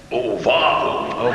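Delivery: low, slow nonsense drone; silly wrong-answer noise. Shown as a demonstration. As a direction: groan it out like a goofy buzzer. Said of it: "you'll hear this noise".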